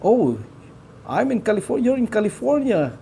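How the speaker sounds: ordinary talk, nothing marked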